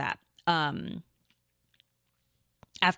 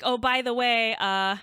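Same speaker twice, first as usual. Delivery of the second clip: nervous announcement; 5 semitones higher, 4 dB louder than usual. Retold or imitated. Announcing pregnancy to mom.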